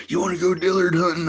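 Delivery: a high, goofy buddy's invite; high mock friend voice.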